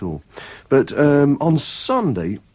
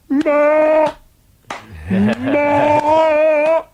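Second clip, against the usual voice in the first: mock crowd shout; 5 dB louder than usual. Crowd calling for more.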